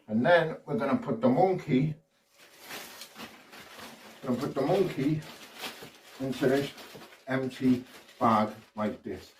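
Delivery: ordinary talk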